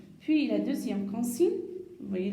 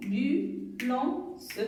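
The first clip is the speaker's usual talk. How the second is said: slow syllable reading; much slower than usual. Shown as a demonstration. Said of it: "Si on frappe la main".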